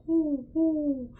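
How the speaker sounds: high hooting owl oohs. Shown as a demonstration. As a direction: hoot like an owl. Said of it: "that egg in our mouth".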